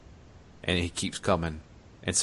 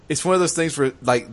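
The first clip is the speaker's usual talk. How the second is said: ordinary talk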